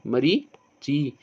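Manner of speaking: Marichi drawn out slowly, short ि at the end; slow careful demo. Shown as a demonstration. (Marichi को बहुत धीरे, खींचकर बोलें)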